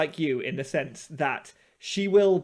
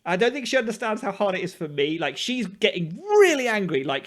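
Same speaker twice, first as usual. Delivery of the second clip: usual speaking voice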